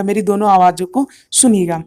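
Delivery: ordinary delivery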